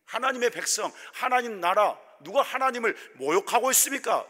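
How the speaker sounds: high, loud, outraged protest building up; indignant outcry. Retold or imitated. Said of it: "이랬을 것 같아요". (분노하며 점점 커지는 외침)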